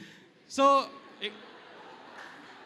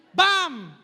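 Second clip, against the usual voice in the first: loud kick thud; 4 semitones higher, 6 dB louder than usual. Shown as a demonstration.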